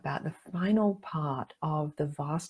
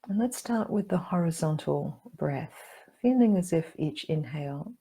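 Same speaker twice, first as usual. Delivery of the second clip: calm meditation guide with long pauses; guided meditation. Acted. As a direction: guide them gently inward, leaving room to breathe.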